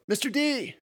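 high, sudden call. A narrator's reading. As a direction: call to the teacher and startle him. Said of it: "At the call of".

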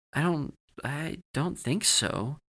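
falter, doubting what just happened; halting, uncertain reply; unsure. A narrator's reading. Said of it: "he was really unsure what just happened".

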